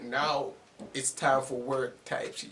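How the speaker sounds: ordinary delivery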